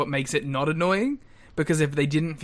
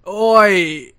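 a loud mock British "Oi!"; 5 semitones higher, 12 dB louder than usual. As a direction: bark "Oi!" like a cheeky Brit, loud. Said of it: "Oh, you're British".

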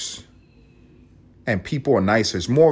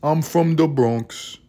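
slow, higher laid-back Bronx voice; relaxed Bronx accent impression; 5 semitones higher, slower than usual. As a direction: drawl it like a chill Bronx local. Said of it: "They all talk like this".

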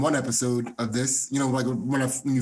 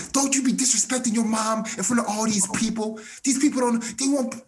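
put-on higher, sing-song scolding of a kid in public; mock-scold the kid, pitched up and half sung; mom's mock scolding in a theater, pitched up; imitating mom yelling; 8 semitones higher than usual. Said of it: "My mom's yelling in the movie theater".

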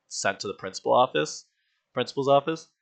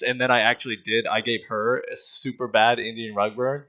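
ordinary delivery

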